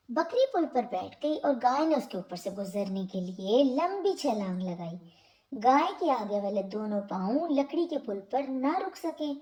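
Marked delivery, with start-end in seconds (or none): none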